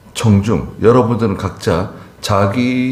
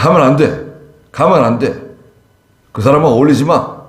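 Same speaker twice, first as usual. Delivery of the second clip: firm command; 7 semitones higher, 4 dB louder than usual. Acted. Preacher voicing God's clear commands.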